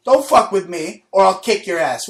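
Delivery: normal talking voice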